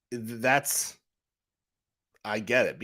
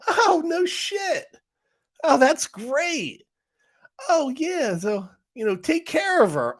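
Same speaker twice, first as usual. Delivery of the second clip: mock gleeful insider; slower than usual. Retold hearing, acted banter.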